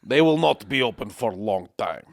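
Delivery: slow, exaggerated Russian accent; thick Russian accent. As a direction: spell it out slowly, heavily Russian. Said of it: "an accent that was so Russian".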